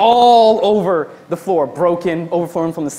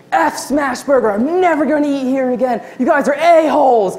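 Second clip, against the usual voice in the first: hammed-up angry ranting in a raised voice; cursing out; 5 semitones higher than usual. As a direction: imitate a customer storming off in outrage. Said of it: "She starts cursing me out".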